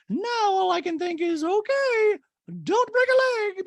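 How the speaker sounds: high, loud anxious lady; Dorit impression. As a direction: play Dorit fretting. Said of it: "Dorit in a talking head goes".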